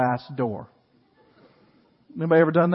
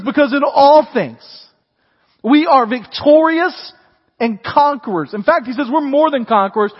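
higher, louder, slowed proclamation; slow, louder triumphant sermon; triumphant preaching; 6 semitones higher, 6 dB louder than usual. Acted. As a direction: declare victory to the congregation.

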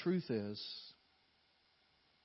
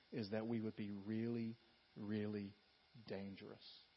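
hushed emphasis; 3 semitones lower, 6 dB quieter than usual. Acted. Pastor echoes the son's prayer.

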